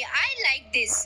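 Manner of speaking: very slow, stretched-out I like this, falling at the end; mock-savoring the words "I like this"; Mithun's catchphrase. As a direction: drag out "I like this" like a signature line. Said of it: "त्यावर हे मिथुन भाऊजी म्हणतात".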